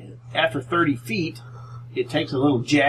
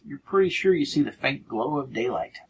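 usual speaking voice

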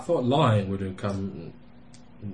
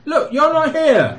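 shouting; 11 dB louder, slower than usual. Acted. Shouting at the door.

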